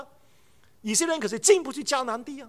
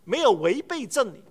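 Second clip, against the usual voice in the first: ordinary talk, nothing marked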